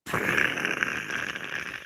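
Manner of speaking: sudden burst of hissing noise; imitated flare-up. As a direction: mimic a character going off instantly. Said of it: "goes, it's from nothing to instant".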